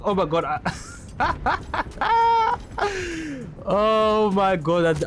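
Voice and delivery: high, helpless laughter through the words; real laughing fit. Real, not acted. Player cracks up at own fall in game, laughing hard. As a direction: crack up mid-sentence.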